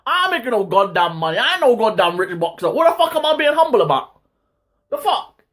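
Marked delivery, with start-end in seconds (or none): 2.7-5.4 incredulous outburst. Real, not acted. Vlogger ranting at fans, pitched high in outraged disbelief. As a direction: pitch jumps much higher in disbelief. Rant about staying humble.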